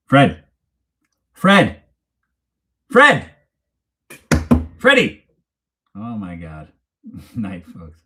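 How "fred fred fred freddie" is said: louder and rising higher with each call of "Fred"; calling the dog. Real, not acted. Streamer calls dog Freddie to say goodnight, rising calls. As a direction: call the dog over, climbing in pitch each time.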